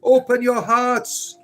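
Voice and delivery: high, ringing preacher's call to the goddess; preaching call. Acted. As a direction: call out to the unbelievers like a revival preacher. Calling viewers to the goddess.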